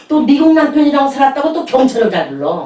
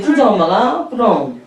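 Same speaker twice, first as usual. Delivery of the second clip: ordinary talk, nothing marked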